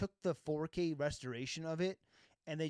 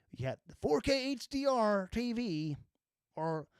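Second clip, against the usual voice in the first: put-on Southern accent; 6 semitones higher, 4 dB louder than usual. Ranting to chat about TV specs, in a mock Southern accent. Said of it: "Why am I doing a Southern accent?".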